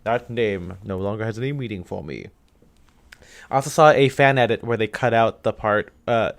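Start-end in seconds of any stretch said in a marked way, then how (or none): 0.0-2.3 a hint of a low, menacing Vader; quietly intoned dark lord line; Darth Vader voice.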